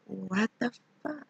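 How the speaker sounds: soft slow stunned mutter; muttered disbelief. Retold or imitated. Muttered reaction to being sent home.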